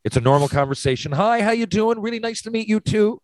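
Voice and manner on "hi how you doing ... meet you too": high, bright put-on politeness; cheerful small talk pretending the face is clean; mock breezy greeting. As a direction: chirp it like nothing is wrong. Acting normal with sauce on face.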